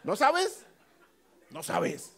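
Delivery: ordinary talk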